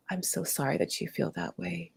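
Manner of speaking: soft, gentle apology to the hurting part; compassionate. Shown as a demonstration. To the hurting part of herself, soft and kind. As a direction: say it softly, like comforting a child. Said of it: "we can say".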